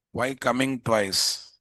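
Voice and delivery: ordinary talk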